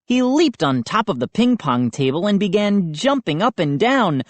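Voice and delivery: ordinary delivery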